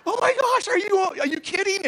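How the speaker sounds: high, loud, gushing disbelief; amazed. Retold or imitated. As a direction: blurt it out in giddy shock. Amazed reaction to sudden healing.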